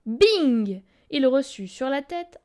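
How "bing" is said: plain reading voice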